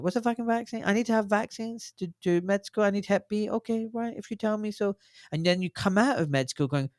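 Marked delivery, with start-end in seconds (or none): 0.0-5.0 clueless student. Retold or imitated. Imitating a med student going into med school, mock clueless. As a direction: sound like you just accept whatever you're told. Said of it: "And you go in going".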